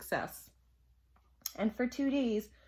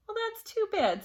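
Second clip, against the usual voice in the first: much higher, louder principal's quote; a hint of a chirpy school principal; lightly voiced principal; 13 semitones higher, 5 dB louder than usual. A narrator's reading. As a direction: lift it high for the principal. Principal reacts to absent pupils.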